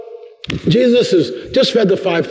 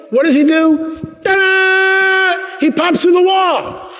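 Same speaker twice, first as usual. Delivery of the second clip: high excited reveal; 14 semitones higher, much slower than usual. Acted. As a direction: squeal it out high and slow like a magic trick. Big reveal, very high far voice.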